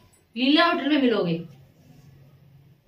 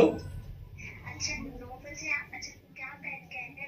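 soft, slow, coy whisper-like voice; 18 dB quieter, slower than usual. Pretend. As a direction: sweet-talk him in a hushed voice. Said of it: "अनजान लड़की बन करके".

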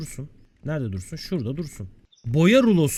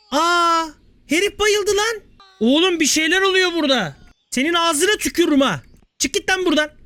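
high-pitched comic panic; alarmed outburst; 9 semitones higher than usual. Real, not acted. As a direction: react to sudden chaos, voice pitched way up. Customer faints amid the chaos. (tiz sesle telaşlı çıkış)

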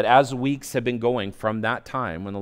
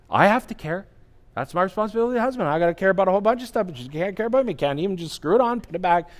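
self-pitying complaint; 8 semitones higher, faster than usual. Retold or imitated.

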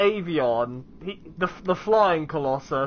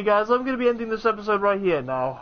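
normal talking voice

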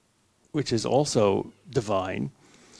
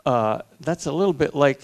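ordinary talk, nothing marked

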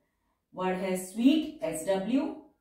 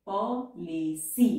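Policy drawn out slowly, syllable by syllable; slow dictation; much slower than usual. Shown as a demonstration. Teacher slowly dictating policy to the students. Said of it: "अब policy".

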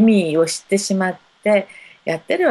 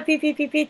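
imitated chirping; 8 semitones higher, much faster than usual.

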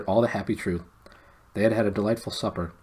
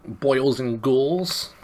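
slow, drawn-out horror host; spooky host voice; 5 semitones higher, 4 dB louder, much slower than usual. Pretend. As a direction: ham it up like a crypt keeper. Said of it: "referenced Tales from the Crypt".

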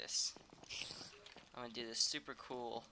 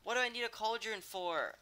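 higher, louder, very slow exasperated wail; fed up; 7 semitones higher, 9 dB louder, slower than usual. Real, not acted. Streamer fed up with his crafting mistake, exasperated wail. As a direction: stretch out the frustration.

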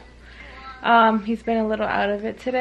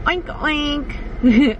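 higher-pitched playful oinks; pig oink; 5 semitones higher than usual. Shown as a demonstration. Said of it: "How about a pig?".

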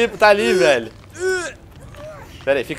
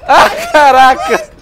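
startled shout; 4 semitones higher, 4 dB louder, slower than usual. Real, not acted. Startled by killer.

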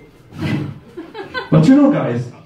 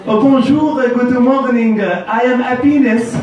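friendly introduction; 8 semitones higher than usual. Retold or imitated. Friend introducing himself at school.

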